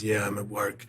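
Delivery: low-key star on the phone; Harrison Ford. Retold or imitated. Film star answering a caller, understated. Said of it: "He goes".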